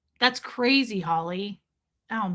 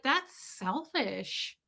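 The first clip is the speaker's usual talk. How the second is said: softer, very slow judging remark; mock disapproval; 3 semitones higher, 9 dB quieter, much slower than usual. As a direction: drag it out, quietly disapproving. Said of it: "I was like".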